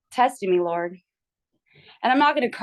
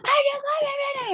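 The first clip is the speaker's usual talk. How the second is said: much higher, mock sweet; high patient voice. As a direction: squeak it like the patient. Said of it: "And she's like".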